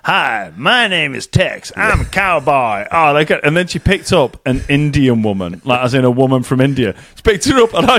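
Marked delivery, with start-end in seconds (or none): 0.1-2.9 put-on slow American cowboy accent; imitated slow Western drawl; Tex. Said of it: "She picked up the cowboy and said".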